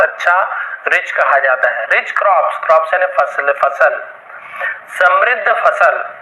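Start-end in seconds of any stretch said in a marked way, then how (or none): none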